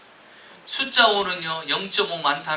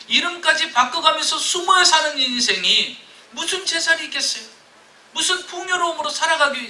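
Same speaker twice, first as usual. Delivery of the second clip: much higher, loud fervent sermon; impassioned preaching; 10 semitones higher, 7 dB louder than usual. Acted. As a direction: preach it with rising passion.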